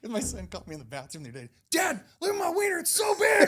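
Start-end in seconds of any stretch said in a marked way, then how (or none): 1.7-3.5 put-on high, loud shouting little boy; excited kid. Son voiced boasting to dad.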